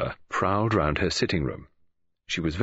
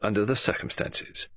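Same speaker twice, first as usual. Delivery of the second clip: primly; 7 semitones higher than usual. A narrator's reading. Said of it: "said Poirot primly".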